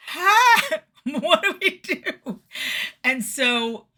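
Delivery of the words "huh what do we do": very high, loud, laughing bewilderment; mock baffled company. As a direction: play Pepsi as flustered and clueless. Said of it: "And Pepsi was like".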